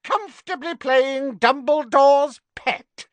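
high, loud furious witch. Acted. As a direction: spit out in fury. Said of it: "In her fury".

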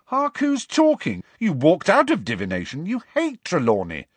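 Hark who's talking, thrown back in protest; indignant. A narrator's reading. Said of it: "said Ron indignantly".

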